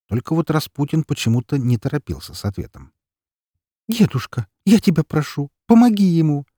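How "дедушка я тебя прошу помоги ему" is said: высокая, громче, отчаянная мольба; умоляй деда о помощи; умоляя. Acted. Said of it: "умоляюще уставилась на деда". (умоляюще, со слезами в голосе)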